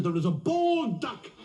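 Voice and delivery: high-pitched, emphatic accusation; accusing park keeper. Acted. Park keeper confronting drunk over bald duck, high-pitched accusation. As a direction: lay out the evidence, incredulous and high.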